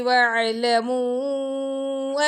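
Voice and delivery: slow, melodic tajweed recitation; Quran recitation. Shown as a demonstration. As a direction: chant the verse slowly and evenly, teaching by example. Teacher recites Quran verse.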